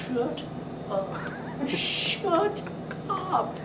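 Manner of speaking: high, soft squeaky shut up; playful hush. Retold or imitated. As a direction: shush them through the giggles. Telling laughing guys to hush.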